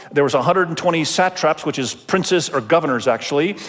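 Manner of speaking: normal talking voice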